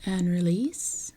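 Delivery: calm meditation guide, long unhurried pauses; guided meditation. Acted.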